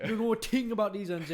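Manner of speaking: fast, high, loud English taunt; English accent. Retold or imitated. Taunting his sister, fast loud English accent. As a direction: rattle it off in a London accent. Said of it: "I'm always like".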